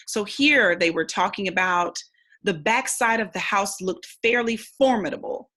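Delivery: normal talking voice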